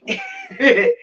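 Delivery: squeaky giggle; giddy laugh at herself; high laugh. Real, not acted. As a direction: burst into a high little giggle. Laughing at her new outfit.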